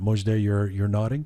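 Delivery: ordinary talk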